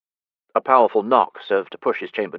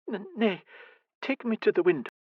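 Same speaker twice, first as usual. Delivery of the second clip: stammering mother; 12 semitones higher, 4 dB quieter than usual. Acted. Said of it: "she stated".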